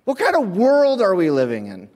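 hammed-up outrage; mock anger. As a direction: cry out for justice. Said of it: "And I was angry".

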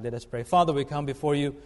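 normal talking voice

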